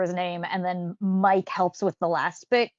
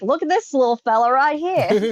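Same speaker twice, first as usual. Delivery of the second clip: put-on higher, excited Australian accent; Steve Irwin; 5 semitones higher, 4 dB louder than usual. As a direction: present it like an Aussie wildlife host. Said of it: "like, Steve Irwin".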